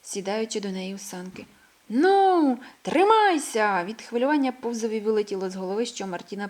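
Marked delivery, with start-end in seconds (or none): 1.9-3.8 higher and louder, drawn out slowly; a mole warning the little hare to hold on, thrilled; excited call. A narrator's reading. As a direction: brace her for the ride. Sledding start, excited call.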